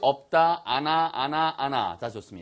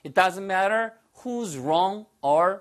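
slow model sentence; 5 semitones higher, much slower than usual. Shown as a demonstration. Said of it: "뒤에다 붙이시면 돼요".

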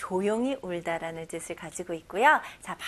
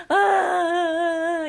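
high, loud, drawn-out mock sob; loud sobbing wail; 7 semitones higher, 7 dB louder than usual. Shown as a demonstration. Teacher demonstrating what a sob sounds like, loud wailing. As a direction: wail "ah" like uncontrolled crying. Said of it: "이런 소리가 나는 게 sob입니다".

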